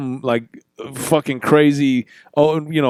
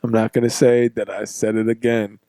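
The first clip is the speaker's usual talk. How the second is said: usual speaking voice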